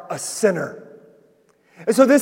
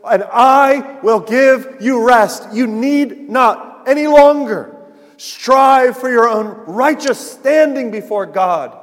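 raised, loud, drawn-out preaching; emphatic proclamation; 4 semitones higher, 6 dB louder, slower than usual. Retold or imitated. Preacher voicing Jesus to the self-righteous, emphatic proclamation. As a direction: proclaim the promise slowly and forcefully.

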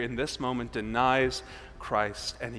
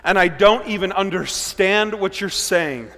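slow, louder flustered denial; Peter denying; 5 semitones higher, 7 dB louder, slower than usual. Acted. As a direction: brush off the accusation, dragging it out. Preacher acts Peter's denial.

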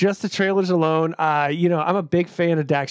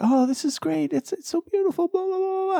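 gushing scientist; 13 semitones higher than usual. Retold or imitated. Mocking scientists' delight.

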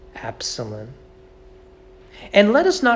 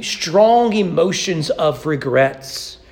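normal talking voice

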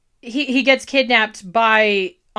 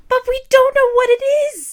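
exasperated outburst; 6 dB louder than usual. Real, not acted. Frustrated fan exclaiming about lore.

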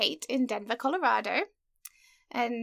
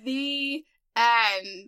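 slow, drawn-out grand ending; capital-letters announcement; 6 dB louder than usual. Retold or imitated. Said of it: "in capital letters".

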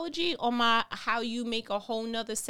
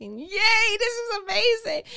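high, loud, gushing yay; mock cheer; 11 semitones higher, 7 dB louder than usual. Preacher mocking the parents' joy, high and loud. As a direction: burst out with fake delight.

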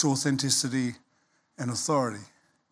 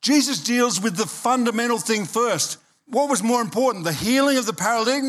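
raised, driving sermon voice; full-force preaching; 6 semitones higher than usual. Acted. Preacher urging the congregation that God still has a plan, at full force.

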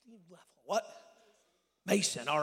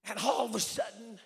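ordinary talk, nothing marked

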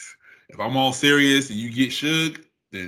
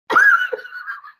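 a sudden high shrieking burst of laughter; 7 dB louder than usual. Real, not acted. Laughing loud at her own random Mars line to a friend.

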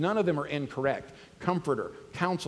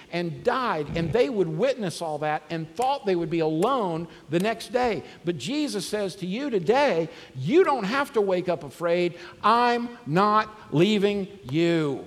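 preaching that swells from urgent to loud, with lines higher and louder; building preaching; 4 semitones higher, 3 dB louder than usual. Acted. Pastor to congregation, from the passion to the promise, building preaching. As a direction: build the crucifixion list into a loud, ringing promise.

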